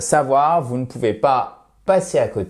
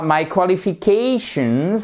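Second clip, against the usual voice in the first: qualifications with CA stressed and drawn out; stress before -tion; 6 semitones higher than usual. Shown as a demonstration. Teacher demonstrates word stress, qualifications stressed before -tions. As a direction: lean on the syllable before the final -tions. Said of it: "vous avez l'accent tonique juste avant".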